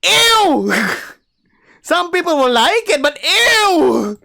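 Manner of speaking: high, loud laughing revulsion; disgusted squeal. Acted. Reader recoils from the loincloth reveal, squealing in disgust.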